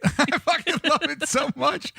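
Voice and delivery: gushing through laughter, pitched high; real giggly, high-pitched enthusiasm; laughing delight. Real, not acted. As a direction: laugh while declaring your love for it. Laughing over favorite movie line.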